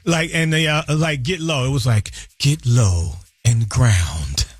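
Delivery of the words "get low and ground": low, very slow meditation voice; smooth radio voice. Shown as a demonstration. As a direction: sink low and draw every word out calmly. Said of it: "I get the smooth radio voice".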